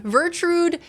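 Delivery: "Vertrude" sung out high; playful. Real, not acted. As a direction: relish the silly name "Vertrude".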